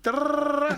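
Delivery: fast rolling rrr like rapid-fire rap; rapid rolled trill. Retold or imitated. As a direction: trill the r to mimic fast rapping. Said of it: "Ils rappent vite".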